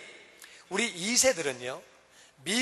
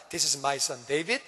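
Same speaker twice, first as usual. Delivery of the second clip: polite introduction; 5 semitones lower, much slower than usual. Retold or imitated. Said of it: "애들도 분명히 악수시키고 인사시킵니다".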